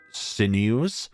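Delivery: ordinary talk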